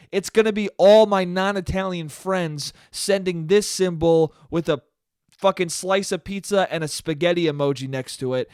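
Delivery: normal talking voice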